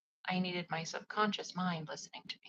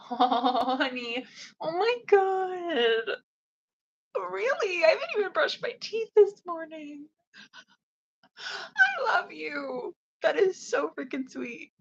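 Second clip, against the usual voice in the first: high, loud, gushing and teary; gushing delight; 9 semitones higher, 9 dB louder, slower than usual. Real, not acted. Replying to her twin flame's compliment, high gushing squeals. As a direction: squeal with overwhelmed joy.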